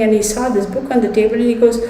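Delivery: usual speaking voice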